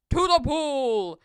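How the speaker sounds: loud, drawn-out rallying call; big slow shout to the crew; firemen's yell. A narrator's reading. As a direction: yell it slowly as a burly fireman. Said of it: "yelled the firemen".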